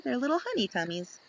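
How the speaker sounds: ordinary delivery, nothing marked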